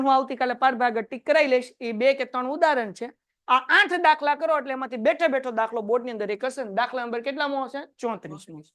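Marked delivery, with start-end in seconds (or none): none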